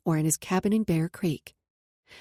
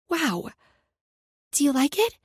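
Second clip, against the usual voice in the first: put-on eager little girl; 5 semitones higher, 4 dB louder than usual. Eager child asking.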